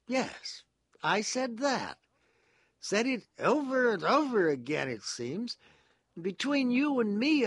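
high, loud, agitated character voice; sententious man confessing with emphatic zeal; earnest confiding prisoner. A narrator's reading.

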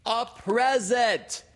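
high, drawn-out little-creature shout; shrieked loudly and slowly for poor hearing; very loud Piglet. A narrator's reading. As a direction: stretch it out in a piercing yell. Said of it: "said Piglet very loudly".

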